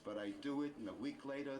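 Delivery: ordinary delivery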